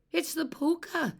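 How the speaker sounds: high, loud frightened shriek; a child's panicked shriek at the fire wagon. Acted. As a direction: scream it in terror. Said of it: "Eilish shrieked".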